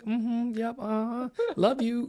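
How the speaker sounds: high, singsong unbothered acknowledgments; imitated calm mom. Friend imitating a calm mother amid chaos, high unruffled replies. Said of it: "you were just so calm".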